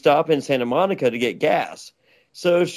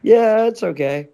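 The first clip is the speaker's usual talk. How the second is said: higher-pitched imitation of her reply; retold breezy dismissal; 7 semitones higher, 4 dB louder than usual. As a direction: wave off the objection, voice lifted. Woman brushing off objection, retold.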